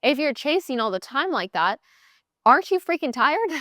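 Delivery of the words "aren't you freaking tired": exasperated. Real, not acted. Exasperated rhetorical question.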